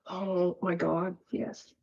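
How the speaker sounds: normal talking voice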